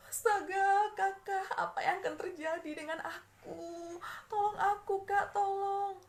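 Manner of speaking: startled high loud cry for help; shocked plea. Acted. Frightened child begs older sibling for help, high and loud. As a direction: panic hitting all at once. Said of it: "Lalu si adik terkejut".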